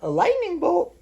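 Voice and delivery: squeaky mock-surprised guess; silly high voice. Parent teasing child with a squeaky high guess. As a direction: pipe it up high as a joke.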